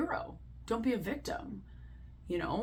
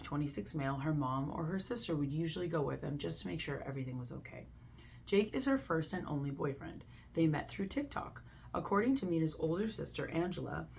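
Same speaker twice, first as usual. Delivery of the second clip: normal talking voice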